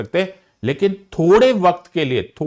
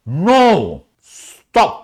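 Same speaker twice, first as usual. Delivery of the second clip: firm stop; 3 semitones higher, 4 dB louder than usual. Acted. Teacher halting a student who wants to finish the topic, sharp loud command. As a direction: cut the student off hard. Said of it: "क्या बात कर रहे हो sir".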